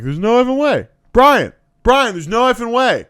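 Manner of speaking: high, loud, exasperated calling out; insistent kid. Retold or imitated. Kid telling Brian off in class, loud high insistence.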